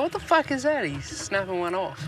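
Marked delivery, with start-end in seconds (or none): 0.0-0.9 surprised. Retold or imitated. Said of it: "I just looked up and go".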